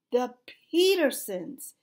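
loud, drawn-out announcement; announcing. A narrator's reading. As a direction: announce the guests with emphasis. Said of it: "Peabody announced".

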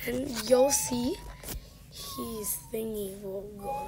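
ordinary talk

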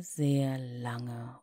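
ordinary delivery

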